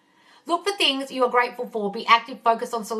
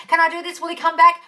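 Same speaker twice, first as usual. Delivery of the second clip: mock anxious follower; 6 semitones higher, 6 dB louder than usual.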